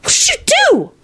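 very high-pitched panicked question; alarmed shriek. Acted. Elizabeth seeing the attackers topple, shrieking in alarm. Frightened girl after the spell.

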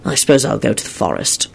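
low, musing reply; thoughtful. A narrator's reading. Lupin deciding how to pass the day, thoughtful. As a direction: muse it aloud. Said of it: "Lupin replied thoughtfully".

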